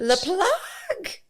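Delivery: a silly high French voice; mock French. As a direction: camp French pronunciation, high and drawn out. Joking French word.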